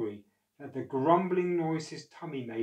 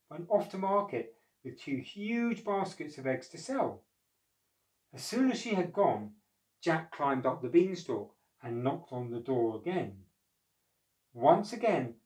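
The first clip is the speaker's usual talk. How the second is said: ordinary delivery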